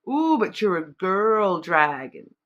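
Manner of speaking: slow, lightly voiced cartoon quote with stress on "girl"; Shrek movie line. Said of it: "the line out of Shrek".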